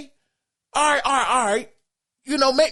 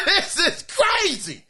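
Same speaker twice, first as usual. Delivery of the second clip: laughing; 7 semitones higher, slower than usual. Real, not acted. Cracking up at listener email.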